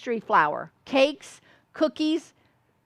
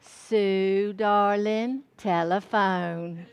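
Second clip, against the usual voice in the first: very drawn-out Southern summons, sinking lower; mother's Southern drawl; 3 semitones lower, much slower than usual. Retold or imitated. Mother calling daughter to the phone from the hall, slow Southern drawl. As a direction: imitate a Southern mother, lingering on every word. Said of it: "she'd come to the hall and she'd go".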